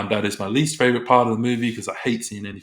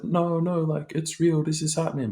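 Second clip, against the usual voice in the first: higher, urgent pleading as Helen; 8 semitones higher than usual. Retold or imitated. Helen begging the disbelieving doctors, higher and urgent. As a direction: insist it's real, pitched up.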